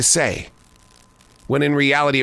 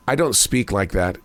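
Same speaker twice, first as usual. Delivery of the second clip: usual speaking voice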